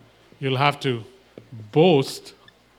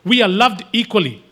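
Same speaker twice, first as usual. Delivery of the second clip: usual speaking voice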